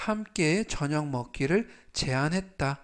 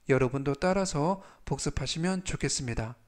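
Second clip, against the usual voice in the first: ordinary talk, nothing marked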